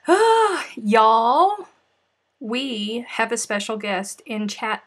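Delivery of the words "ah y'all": loud, high ah, y'all; excited call out. Real, not acted. Host spots a special guest in chat, excited call.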